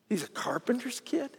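whispered aside full of doubt; scoffing whisper. Retold or imitated. Townsfolk whispering doubts about the carpenter's son. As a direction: hush it like gossip behind his back.

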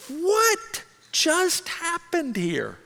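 high, very slow, stretched-out bewilderment; stunned disbelief. Retold or imitated. Snubbed fan left standing alone, stunned disbelief. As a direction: draw each word out, high, as if dazed.

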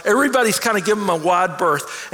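ordinary delivery

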